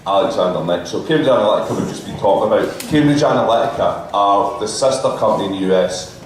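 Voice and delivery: ordinary delivery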